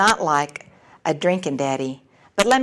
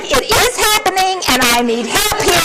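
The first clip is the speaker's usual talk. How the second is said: frantic pleading; 10 semitones higher, 4 dB louder than usual. Retold or imitated. Mother begging sponsor for help, frantic. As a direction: beg for help in mock panic.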